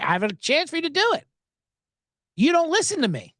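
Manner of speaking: high mock nagging rep; badgering rep. Comic quoting the rep's high nagging complaint. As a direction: nag him, hammed-up. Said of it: "That's what she's badgering me with".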